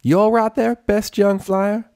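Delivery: very high, slow drawn-out mock; sarcastic teasing. A narrator's reading. Applejack mocking Rainbow's stumble, high sarcasm. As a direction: needle her with sarcasm.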